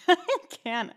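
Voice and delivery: high giggly burst of laughter; laughing. Real, not acted. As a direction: laugh through the words. Cracking up mid-reading.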